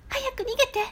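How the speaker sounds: put-on high girlish plea; imitating the girl. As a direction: squeak it out like the girl in the trailer. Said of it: "あの女の子の".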